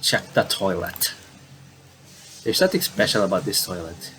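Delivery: normal talking voice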